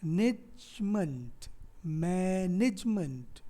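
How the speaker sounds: मैनेजमेंट slowly as mai, nij, munt; मैनेजमेंट drawn out for counting; three-syllable breakdown. Shown as a demonstration. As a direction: separate the three syllables of मैनेजमेंट, no vowel after ज. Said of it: "यहाँ पे कितने syllable हैं".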